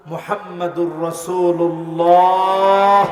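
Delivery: slow, rising swell on the final syllable; long, ringing call for response; drawn-out congregational cue. Real, not acted. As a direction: stretch the last word to cue the crowd. Prompts congregation to answer with blessing.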